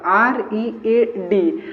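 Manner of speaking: spelled out letter by letter; slow spelling. Shown as a demonstration. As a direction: spell it slowly, one letter at a time. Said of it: "तो रीड मतलब पढ़ना होता है". (धीरे-धीरे अक्षर बोलते हुए)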